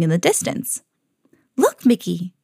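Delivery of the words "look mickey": mildly excited storybook exclamation. A narrator's reading. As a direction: call attention to a discovery. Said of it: "Minnie exclaimed".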